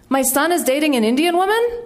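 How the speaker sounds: "My son is dating an Indian woman" climbing high to the end; statement as question. Retold or imitated. Man asking after a Q and A, "woman" rising as a question.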